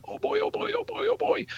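high, fast squeal, voice kept small; excited little boy. Retold or imitated. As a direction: imitate an excited kid hopping up and down. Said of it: "got so excited about his mom".

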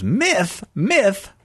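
high, puzzled frog puppet voice; Kermit the Frog voice. Retold or imitated. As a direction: squeak it like Kermit. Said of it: "and Kermit goes".

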